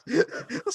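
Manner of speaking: squealing laugh; high squeal of laughter. Real, not acted. Host laughing through his own story, squealing.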